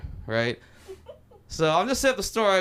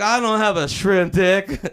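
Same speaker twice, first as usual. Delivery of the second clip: loud, slow, hammed-up denial; indignant protest; 3 semitones higher, 6 dB louder, slower than usual. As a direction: protest it loudly, stretching the words. Brooding over the insult all movie.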